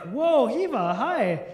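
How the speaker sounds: loud, swooping mock surprise; taken aback. Man reacting to a friend's overshare, taken aback. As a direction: play up the startled, awkward reaction.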